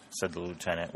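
usual speaking voice

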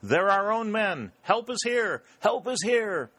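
high, elated cry across the battlefield; joyful shout. A narrator's reading. Dick hails the arriving Union reinforcements from the stump, shouting joyfully. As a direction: shout it high, overjoyed that help has come. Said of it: "shouted at the top of his voice".

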